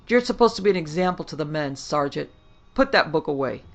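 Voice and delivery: loud, fast scolding order; angry rebuke. Acted. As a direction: dress down the sergeant hard. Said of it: "Lamarck almost roared".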